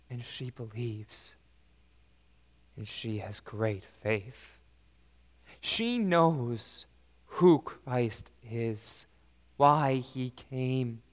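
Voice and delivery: ordinary talk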